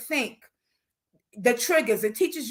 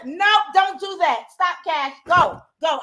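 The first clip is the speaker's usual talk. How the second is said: firm louder scold at the puppy; scolding dog; 4 semitones higher, 5 dB louder than usual. Real, not acted. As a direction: shoo the dog away firmly. Scolding puppy mid-lesson.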